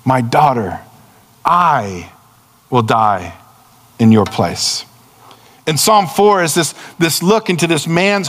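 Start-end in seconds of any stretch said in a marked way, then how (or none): 0.0-4.9 lower, slow, tender plea; a hint of a loving saviour crying out; pleading voice of Jesus. Jesus answering the crowd, a slow low plea. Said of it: "he cries out".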